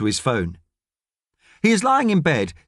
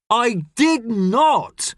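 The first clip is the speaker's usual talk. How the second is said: shouting back; 9 semitones higher, much slower than usual. Acted. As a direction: hammer each word back at him. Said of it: "shouts Thomas back".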